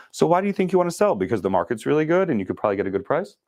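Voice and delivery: high, fast mock chatter answering itself; imitating annoying questioner. Mocking a person who answers own question, fast and high. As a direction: rattle on without waiting. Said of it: "the most annoying thing".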